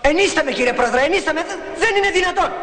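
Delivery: high, agitated courtroom protest; panicked objection. Acted. Husband objecting to the judge's alimony ruling, panicked. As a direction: object in alarm, pitch climbing.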